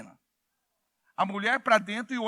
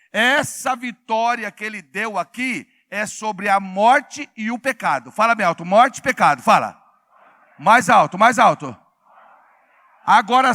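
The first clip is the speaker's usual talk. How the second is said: loud, rousing preacher calling out to the church; full-force preaching; 8 dB louder than usual. Acted. Pastor rousing the congregation, loud fervent preaching. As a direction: drive it home to the congregation, loud.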